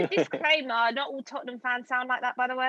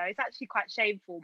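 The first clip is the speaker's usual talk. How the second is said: usual speaking voice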